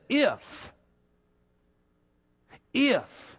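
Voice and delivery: if lifted high and held alone; a single drawn-out if, set apart; stress on if. Shown as a demonstration. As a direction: lean hard on the word if. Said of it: "a word there that starts that out".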